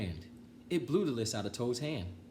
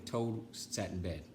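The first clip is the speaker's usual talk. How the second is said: ordinary delivery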